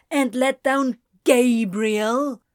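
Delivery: slow, loud mocking jab; taunting. A narrator's reading. Anthony taunting Ezra about Gabriel, slow and mocking. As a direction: drag it out to needle him. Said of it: "in a taunting way".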